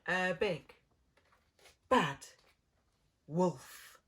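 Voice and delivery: big bad wolf punched loud and slow; dramatic build. A narrator's reading. Storyteller to children, booming out the wolf's name. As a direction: boom out each word like a warning.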